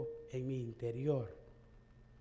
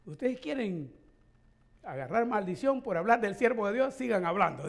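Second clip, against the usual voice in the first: a mild stern rebuke, a bit louder; retold warning; 4 semitones higher, 6 dB louder than usual. Church man warning the congregation, mildly stern. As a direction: threaten the gossips with a curse. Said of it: "dijo el hombre".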